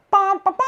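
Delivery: high sung-out trumpet fanfare; high "bum-ba-ba" horn call; loud trumpet imitation. A narrator's reading. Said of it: "they would blow really loudly".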